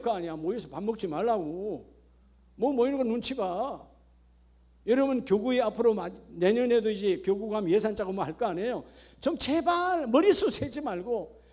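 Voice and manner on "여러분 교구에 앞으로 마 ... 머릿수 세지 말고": usual speaking voice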